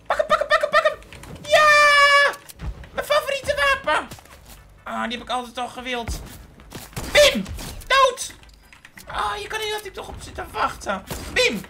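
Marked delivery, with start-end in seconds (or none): 0.1-4.1 very high, loud urgent yells; seizing the sniper, shrieking; ecstatic shriek. Real, not acted. Grabbing the sniper. 7.1-11.6 very high, loud yell; gleeful shriek. Real, not acted. Kill, high voice.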